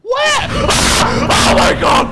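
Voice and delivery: high frantic scream; terrified, searching wildly; panicked shout. Real, not acted. Panicking at the monster.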